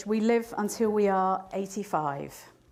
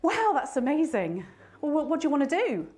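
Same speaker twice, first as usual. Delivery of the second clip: 1 excited reply; 7 semitones higher, 4 dB louder than usual. Retold or imitated. Young Wendy answering friend.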